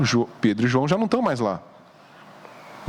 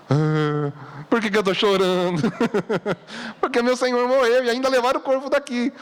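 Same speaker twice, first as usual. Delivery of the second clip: hammed-up tearful whine, higher pitched; mock weeping; 7 semitones higher than usual. Preacher acting out how we would answer the angels, mock weeping. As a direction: wail it in a put-on sobbing voice, played for laughs.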